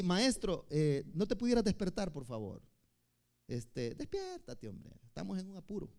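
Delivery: soft, sleepy, half-hearted asking; mock the drowsy way we pray, quietly; lazy polite request. Disciples drowsily nudging their master awake, softly and lazily. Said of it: "No, dice que gritaron".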